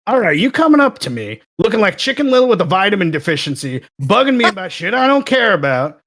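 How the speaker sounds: a sarcastic roast shouted at a coworker; retold yelling. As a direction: tear into him, half joking. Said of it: "I know you're yelling at him".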